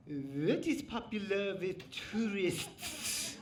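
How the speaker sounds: slow mock dad bombarding the check-in desk; imitating dad. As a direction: play the dad, drawn out like a tourist. Where he bombards them.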